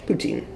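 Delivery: put-on "poutine" as poo-TEEN, the English way; common English pronunciation. Shown as a demonstration. Cook defending how people she knows say it, anglicized "poutine". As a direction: show "poutine" pronounced poo-TEEN, with a clear final n. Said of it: "Most of the people I know pronounce it".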